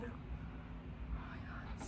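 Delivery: slow, breathless whisper; awed whisper. Real, not acted. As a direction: whisper it in wonder. Awed by the camera.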